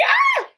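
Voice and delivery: very high short shriek; startled scream. Real, not acted. Startled by the boiling pot, high short scream. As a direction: yelp of alarm.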